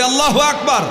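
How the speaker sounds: high, loud rallying cry of Allahu Akbar; loud call. Real, not acted. Preacher leading the crowd in a loud takbir. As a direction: shout it out to lift the crowd. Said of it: "জোরে বলি".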